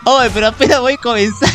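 loud, high and fast complaint; outraged protest. Real, not acted. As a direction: blurt it out indignantly, cheated by the game. Killed right at the start.

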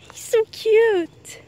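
cooing. Real, not acted. Gushing over baby beaver.

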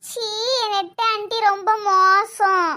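put-on slow, drawn-out childish scolding; five-year-old child. Child scolding auntie for eating biscuits, childlike. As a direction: huff like an offended little kid. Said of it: "அஞ்சு வயசு குழந்தைதான்".

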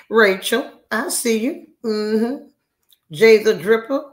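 high, loud roll call; calling out names. Real, not acted. Host spotting Rachel and Jay, calling out.

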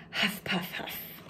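soft breathy puffs from running; out-of-breath huffs; huffing and puffing. Acted. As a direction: puff hard like you just ran home. Bear rushing home.

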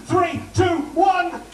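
high, loud countdown to launch the applause; countdown shout. Shown as a demonstration. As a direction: call it out to cue the crowd. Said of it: "you're about to erupt".

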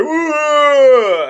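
high, drawn-out, swelling ghost "wooo"; mock-spooky wail, loud and held; imitated ghostly wail. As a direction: wail like a ghost trying to scare someone. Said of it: "is trying to scare him".

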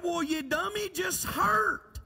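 high-pitched exasperated mock yell; retold yell. Father scolds ailing daughter, acted exasperated yell. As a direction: imitate a fed-up father snapping. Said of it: "You yelled at me".

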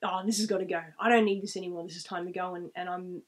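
ordinary talk, nothing marked

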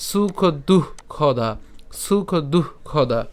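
"সুখ দুঃখদা" very slowly, word by word; pronunciation demonstration. Shown as a demonstration. Teacher modelling for learners, slow distinct "সুখ দুঃখদা". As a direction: draw out "সুখ দুঃখদা" with an audible h in দুঃ. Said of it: "খেয়াল করুন উচ্চারণ কেমন করে করছি".

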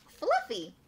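very high, delighted name reveal; high-pitched storybook flourish; gleeful reveal. A narrator's reading. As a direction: squeal the pet's name. Final reveal of the pet's name.

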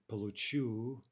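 получу stressed on the final чу; stress on the ending. Shown as a demonstration. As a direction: push the stress to the end of получу, on чу. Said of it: "right, on the ending".

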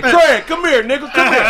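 high, loud call across the house; yelling a name. Retold or imitated. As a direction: shout for your son from the next room. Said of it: "He started yelling my name".

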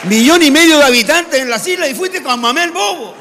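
high, loud incredulous outburst; exasperated shout. Acted. Islander scolding a peninsular friend, exasperated shout. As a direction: shout it high in mock outrage.

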